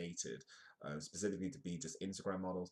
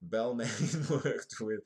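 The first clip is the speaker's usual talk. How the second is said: loud, laughing through the words; amused laughter; 5 semitones higher, 10 dB louder, much slower than usual. Real, not acted. Host amused at misreading Balmain as Batman, laughing loudly. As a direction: break into laughter while reading on.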